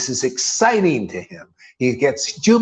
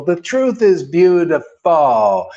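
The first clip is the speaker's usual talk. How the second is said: ordinary delivery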